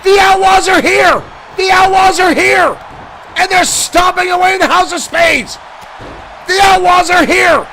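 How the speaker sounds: usual speaking voice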